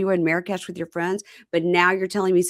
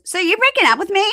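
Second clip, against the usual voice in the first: high, loud mock disbelief; shrill mock shock; 14 semitones higher, 7 dB louder than usual. Retelling the reality show fight, high shrill mock shock. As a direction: squeal it like she can't believe it.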